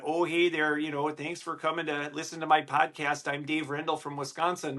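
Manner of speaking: a light Midwestern Wisconsin twang, mildly put on; Wisconsin accent. Shown as a demonstration. Host greets podcast listeners in a light Wisconsin accent. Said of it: "a little bit of that Fargo".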